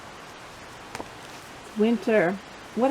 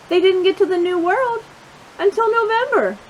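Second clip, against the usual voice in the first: usual speaking voice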